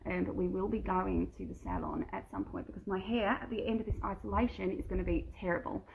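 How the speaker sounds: ordinary talk, nothing marked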